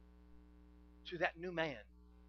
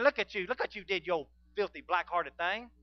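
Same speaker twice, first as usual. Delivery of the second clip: mock accusation, raised voice; accusing; 2 semitones higher, 7 dB louder than usual. Preacher acting out what the Holy Ghost never says, accusing. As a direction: point the finger with your voice. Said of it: "point his finger and accuse you".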